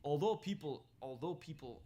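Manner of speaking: put-on testing a Russian accent on the opening words; attempted Russian accent. Host retrying the paper's opening, tentative Russian accent. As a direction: try out a Russian accent, unsure. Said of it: "how do you do a Russian accent?".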